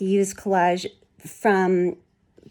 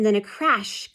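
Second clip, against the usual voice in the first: plain reading voice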